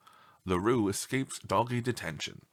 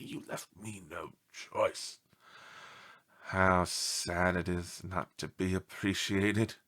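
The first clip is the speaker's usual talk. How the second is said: self-pity; 5 semitones lower, 5 dB quieter, slower than usual. Acted. Melodramatic dog self-pity.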